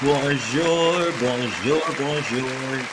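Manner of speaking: bonjour repeated in a mock French sing-song; playful bonjour. Host greeting chat with a mock French bonjour, playful. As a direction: toss out bonjour bonjour bonjour with a lilt.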